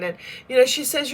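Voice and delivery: normal talking voice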